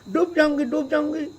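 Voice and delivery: high, loud panicked cries; frightened screams. Retold or imitated. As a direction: shriek as the boat rocks under you. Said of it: "भयभीत हो करके चिल्ला रहे हैं".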